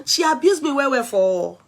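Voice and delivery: usual speaking voice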